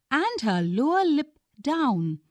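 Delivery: usual speaking voice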